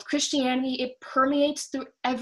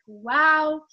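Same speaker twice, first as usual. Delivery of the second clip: louder, lifted, amazed; awed wow; 4 semitones higher, 6 dB louder than usual. Retold or imitated. Watching The Chosen, amazed by Jesus. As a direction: let the wonder spill out.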